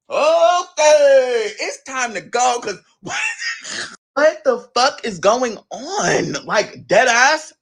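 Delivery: slow, laughing almost to tears; cracking up. Real, not acted. Storyteller losing it laughing at the memory, slow and teary. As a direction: break down laughing mid-sentence.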